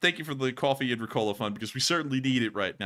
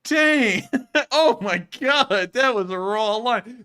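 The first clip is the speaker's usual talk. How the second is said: loud, much higher laughing outburst; stunned delight; 10 semitones higher, 7 dB louder, much slower than usual. Real, not acted. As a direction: blown away by a brutal joke, laughing. Reacting to a savage roast.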